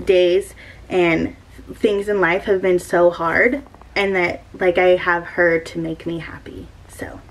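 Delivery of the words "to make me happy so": whisper. Real, not acted. Emotional tribute to her cat.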